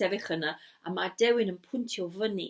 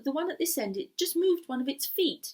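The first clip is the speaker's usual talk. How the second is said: ordinary delivery, nothing marked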